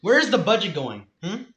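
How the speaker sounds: loud, high-pitched indignant demand; mock outrage. Mock taxpayer pressing about the battleship budget, loud outrage. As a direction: press the question in pretend fury.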